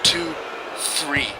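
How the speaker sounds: loud play-by-play calling; wrestling commentator. Acted. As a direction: call every move loudly. Calls the match play-by-play.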